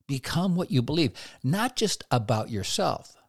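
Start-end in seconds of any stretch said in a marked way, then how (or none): none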